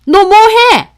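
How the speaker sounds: very high, loud outraged demand; angry challenge. Acted. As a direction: yell as an affronted mother-in-law. Mother-in-law challenges her.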